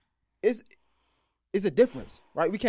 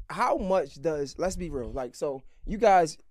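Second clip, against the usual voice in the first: ordinary delivery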